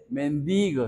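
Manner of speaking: mendigo stressed on the I, men-DI-go. Shown as a demonstration. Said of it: "no hay que ponerle el acento en la E".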